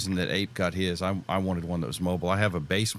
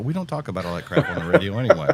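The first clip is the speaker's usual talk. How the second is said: laughing; 6 semitones higher, 6 dB louder, faster than usual. Real, not acted. Laughing at his own joke.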